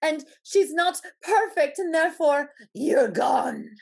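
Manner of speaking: high, loud, hammed-up condemnation; mock judgmental follower. Mocking the follower who unfollowed Cassandra, high and loud. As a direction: play the outraged ex-fan pronouncing doom.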